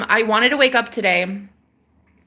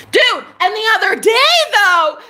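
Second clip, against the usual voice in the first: excited outburst; 11 semitones higher, 5 dB louder than usual. Real, not acted. Bursting out with new story.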